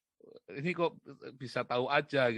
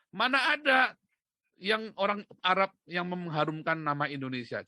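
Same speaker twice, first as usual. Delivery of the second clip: emphatic challenge; 3 semitones higher, 6 dB louder than usual. Real, not acted. Speaker daring listeners to name just one, loudly incredulous. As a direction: call it out as a rhetorical challenge.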